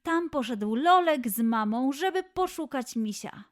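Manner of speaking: usual speaking voice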